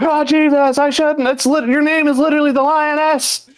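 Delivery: high falsetto lament; mock regretful woman. Imitating Amanda scolding herself, high whine.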